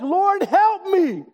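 high, drawn-out imitated cry for help; yelling back desperately. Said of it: "But she yells back".

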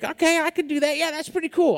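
a hint of naive enthusiasm; mock eagerness.

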